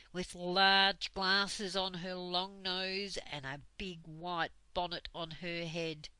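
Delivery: plain reading voice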